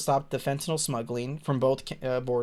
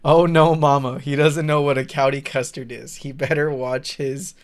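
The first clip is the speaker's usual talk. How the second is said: mock dramatic read; 7 dB louder than usual. Reading a chat message aloud.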